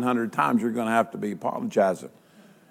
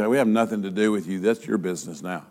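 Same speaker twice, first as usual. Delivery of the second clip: dismissive brush-off; 7 semitones lower, 8 dB quieter, faster than usual. Acted. Leaders brush off Judas.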